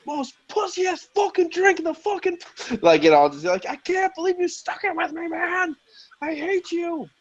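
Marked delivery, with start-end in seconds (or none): 0.0-2.4 very high put-on whiny rant; comic high-pitched griping; mock complaint. Acted complaint to man on phone. 3.7-7.1 very high, softened falsetto whine; comic high-pitched lament; mock despair. Acted complaint, high put-on voice.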